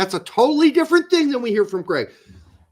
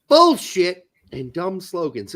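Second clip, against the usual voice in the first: usual speaking voice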